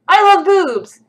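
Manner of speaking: loud, high mock fan cheer; enthusiastic mock yell. Streamer reading out a viewer's comment, enthusiastic mock yell. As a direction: belt it out like an overexcited fan.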